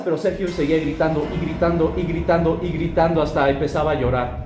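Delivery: ordinary delivery, nothing marked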